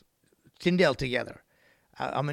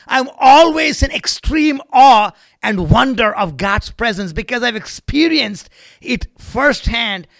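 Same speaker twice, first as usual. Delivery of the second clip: high, booming testimony of awe; impassioned preaching; 8 semitones higher, 12 dB louder than usual. Real, not acted. Preaches at full force.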